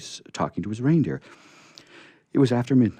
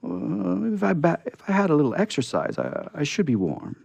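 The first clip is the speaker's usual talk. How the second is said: shivering; 10 semitones higher, 3 dB louder than usual. Acted. Shivering scarecrow answering.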